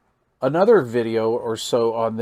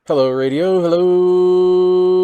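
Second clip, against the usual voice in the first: loud, higher test call into the mic; 6 semitones higher, 9 dB louder, slower than usual. Real, not acted. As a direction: call out to check the transmit meter. Testing radio mic into transmitter.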